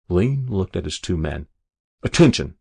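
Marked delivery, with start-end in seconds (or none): 2.0-2.6 loud military order; barked command. A narrator's reading. Officer to his two privates at the grave, barking. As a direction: call the men sharply to attention. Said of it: "he barked".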